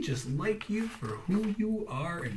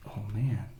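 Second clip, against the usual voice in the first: usual speaking voice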